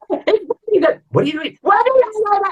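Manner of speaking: high-pitched, drawn-out mock panic; worked up. Onlooker to the baker at work, all worked up. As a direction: squeal it in hammed-up alarm. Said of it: "what are you getting all worked up about".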